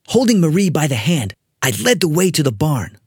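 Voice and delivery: ordinary delivery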